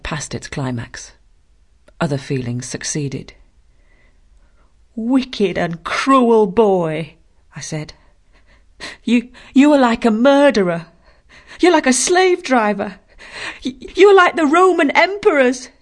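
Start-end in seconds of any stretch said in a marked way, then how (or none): 4.9-7.1 slow, louder, biting accusation; indignant outburst. Acted. Jane finally lashes out. 9.0-15.7 much louder, higher, stammering rage; furious outburst. Acted. Jane's furious accusation.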